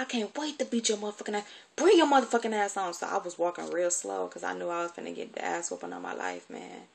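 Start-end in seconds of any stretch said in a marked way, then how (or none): 0.0-2.9 higher, fast, angry threat rising to a yell, imitating the aunt. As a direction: threaten the beating, then bark the order. Auntie threatening a beating.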